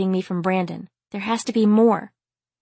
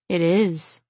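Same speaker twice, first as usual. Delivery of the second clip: grim. A narrator's reading. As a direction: say it heavy and grim. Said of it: "His voice was grim".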